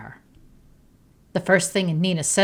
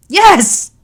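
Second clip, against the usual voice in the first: high, loud yell in tears; much higher and louder, crying out; tearful yell; 14 semitones higher, 14 dB louder than usual. A narrator's reading. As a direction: scream the confession, heartbroken. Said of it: "yells back in tears".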